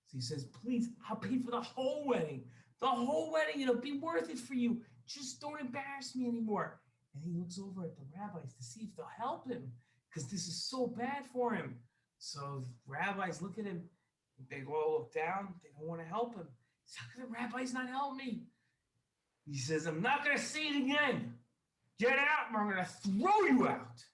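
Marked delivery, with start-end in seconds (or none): 0.5-6.8 high, loud, frantic bargaining; desperate pleading. Acted. Shamed guest offers the whole wedding to stay, desperately pleading. 17.0-18.5 high-pitched, acted disbelief; dismayed appeal. Shamed guest wonders why the rabbis ignore him, dismayed. 19.9-24.1 high, loud acted ultimatum; angry threat. Wealthy host gives his enemy a last warning, shouting angrily. Host's final threat to eject guest.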